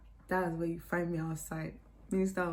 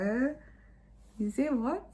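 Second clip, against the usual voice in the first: shocked; 7 semitones higher than usual. Retold or imitated. Shocked retort.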